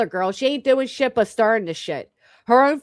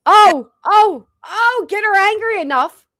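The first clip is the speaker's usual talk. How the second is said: excited mocking exclaim; 8 semitones higher, 6 dB louder than usual. Real, not acted. Mocking reaction to clip.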